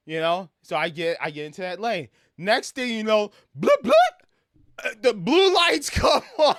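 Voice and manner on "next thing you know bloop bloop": playful siren noise; siren imitation. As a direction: chirp it like a cop car siren.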